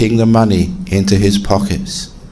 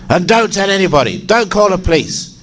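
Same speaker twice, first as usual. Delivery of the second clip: mock shout; 9 semitones higher than usual. A narrator's reading. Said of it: "the burglar shouted at the abbot".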